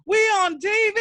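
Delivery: high, loud TV-host shout; Ken Pichel catchphrase shout. Retold or imitated. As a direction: belt it out like a hyped-up host. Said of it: "like my good friend Ken Pichel always says".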